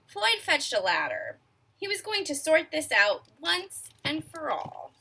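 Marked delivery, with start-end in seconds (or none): none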